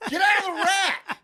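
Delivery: high-pitched hammed-up yell; mock frustrated yell. Frustrated lifter to someone hogging the rack, high mock yell. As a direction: explode in mock exasperation.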